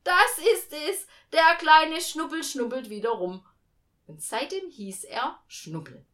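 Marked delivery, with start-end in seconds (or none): 0.0-3.4 high, loud family exclamation; delighted calling out. A narrator's reading. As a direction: call it out loudly for everyone to hear. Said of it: "hatten gerufen".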